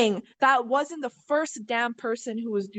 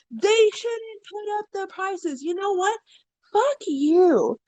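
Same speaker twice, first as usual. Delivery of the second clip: higher and slow, building to a mock yell; retold angry tirade; 6 semitones higher, slower than usual. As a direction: imitate the customer losing it, ending in a curse. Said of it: "whole tirade".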